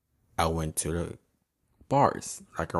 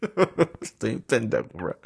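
laughing; 3 semitones higher, slower than usual. Real, not acted. Laughs at own joke.

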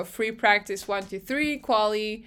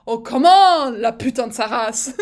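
imitated exasperated outburst, loud at first; big "come on", then curse falling back; hate in the voice; 7 dB louder than usual. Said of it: "the hate in his voice".

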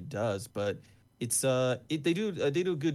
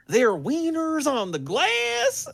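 very high, slow, loud West Texas disbelief; second cop repeating in a West Texas accent; 9 dB louder, much slower than usual. Retold or imitated. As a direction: drag out the shocked question, West Texas style. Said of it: "my West Texas accent".

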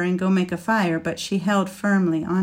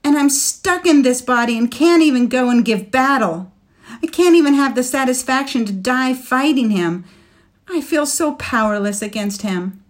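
higher and much louder, desperate, near tears; nearly crying; 6 semitones higher, 7 dB louder than usual. A narrator's reading. Woman lamenting she cannot fight the dragon, desperate near tears. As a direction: cry out your helplessness. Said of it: "She was nearly crying now".

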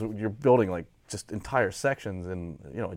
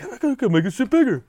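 much higher, louder frantic Sal impression; freaking-out Sal; 13 semitones higher, 10 dB louder, faster than usual. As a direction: squeal it like Sal panicking. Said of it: "Sal said some shit".

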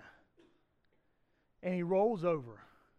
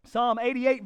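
ordinary delivery

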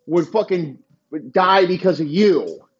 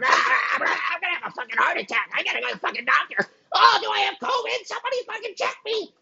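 much higher, fast, shouting panic voice; mock panicking fan; faster than usual. Mocking a fan panicking about covid, high shouting. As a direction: shriek like a hysterical commuter.

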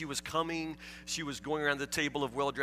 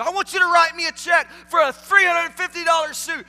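hammed-up tantrum; 15 dB louder than usual. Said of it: "customer demands check".

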